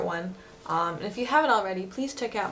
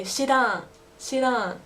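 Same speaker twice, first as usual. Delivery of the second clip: Osaka dialect; 4 semitones higher, slower than usual. Shown as a demonstration. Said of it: "in Osaka Ben, you can say".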